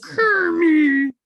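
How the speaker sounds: high, loud swooning Piggy; Miss Piggy voice. Retold or imitated. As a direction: wail his name like a diva pig. Miss Piggy crying for Kermit.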